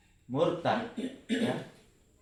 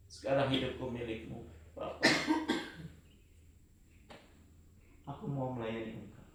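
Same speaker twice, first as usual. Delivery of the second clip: soft, low prayer of surrender; hushed surrender; 6 semitones lower, 9 dB quieter than usual. Retold or imitated. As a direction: whisper your life over to Him. (doa penyerahan yang lirih)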